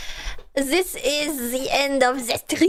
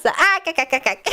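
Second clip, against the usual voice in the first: Mister Krabs laugh; faster than usual. Retold or imitated. Imitating the cartoon crab's laugh for chat. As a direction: bark out a cartoon crab's cackle. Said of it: "Mister Krabs".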